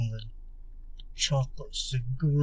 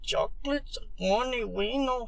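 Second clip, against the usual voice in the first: mock Chicano accent, higher and called out; 8 semitones higher, 5 dB quieter than usual. Showing friends how they say it, mock Chicano accent. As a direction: belt the name out in a joke Chicano accent. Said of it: "It's a little, little Chicano".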